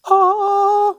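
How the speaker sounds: loud high-pitched held note; singing a high "ah"; high sung "ah". Real, not acted. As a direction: let out a long falsetto "ah".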